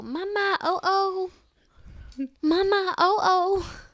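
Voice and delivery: put-on high, loud toddler calling for Mama; impatient child imitation. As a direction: mimic a kid nagging Mama urgently. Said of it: "Damon kinda does the same thing".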